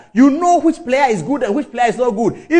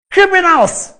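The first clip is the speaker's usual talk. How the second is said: higher, sharp indignant cry; indignant outburst; 8 semitones higher, 4 dB louder, slower than usual. Real, not acted. Speaker condemning Anas to listeners, indignant outburst. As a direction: fling the word out in disgust.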